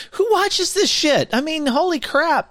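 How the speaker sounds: who would watch this, said in disbelief; incredulous. Real, not acted. Incredulous rant about the parade.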